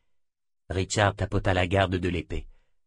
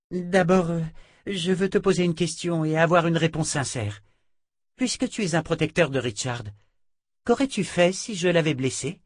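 higher-pitched character voice; a hint of a higher voice, demanding honesty; lightly voiced Jensen, questioning; 7 semitones higher than usual. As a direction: put the question in a raised, earnest voice.

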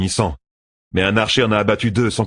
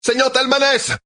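much higher distant shout. A narrator's reading. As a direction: call out from afar. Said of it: "cria soudain une voix".

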